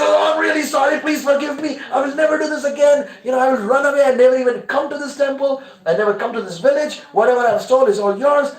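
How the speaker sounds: high, loud panicked begging; frantic cry for mercy, voice way up; terrified pleading. Acted. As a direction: beg for your life at a shout. Thief caught, begging for life.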